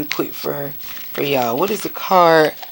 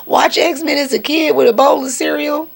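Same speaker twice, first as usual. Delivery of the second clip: mock indignation; 12 semitones higher than usual. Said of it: "I'm like".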